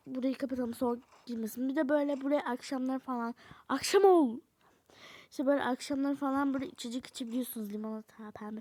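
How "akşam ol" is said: commanding. Acted. Commanding game to night.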